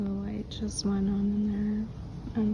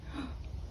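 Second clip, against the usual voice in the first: sharp gasp; 4 semitones higher, 11 dB quieter than usual. Real, not acted. Startled after hearing ghost voice.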